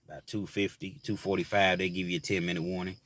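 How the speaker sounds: ordinary talk, nothing marked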